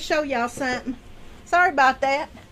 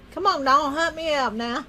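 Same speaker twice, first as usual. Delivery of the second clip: ordinary talk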